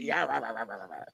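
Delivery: dismissive gibberish imitation of scolding; mimicked ranting. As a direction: rattle off a mock rant, fading away.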